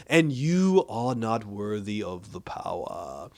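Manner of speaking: slow, drawn-out grandiose pronouncement; mock-grandiose villain. Starman rebuffs Courtney over the staff, mock-grandiose. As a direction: proclaim it slowly, like a hammy supervillain.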